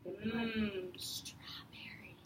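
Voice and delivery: slow whispered relish; savoring whisper. Acted. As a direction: taste it and whisper your delight. Moose lands in ice cream.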